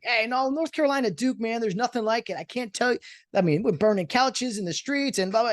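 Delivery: high, eager voice of a Duke rivalry fan; fired-up North Carolina fan. Retold or imitated. Cadet from North Carolina bragging about the Duke rivalry, high and eager. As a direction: hype up your college rivalry, voice pitched high. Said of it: "The North Carolina guy's like".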